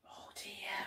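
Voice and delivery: whispered, barely there; hushed dismay. Pretend. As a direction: murmur it under your breath in concern. Narrator reacts to upset.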